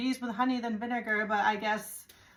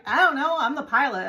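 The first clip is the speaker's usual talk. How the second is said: higher, louder mock-helpless pilot; clueless pilot; 4 semitones higher, 6 dB louder than usual. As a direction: play the pilot as hopelessly clueless.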